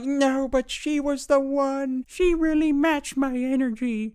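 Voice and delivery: put-on high, slow kid whining; whiny kid voice. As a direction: drag it out like a sulking boy. Kid crying about girlfriend.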